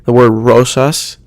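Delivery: ordinary talk, nothing marked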